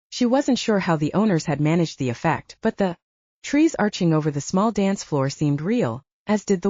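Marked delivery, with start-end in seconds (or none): none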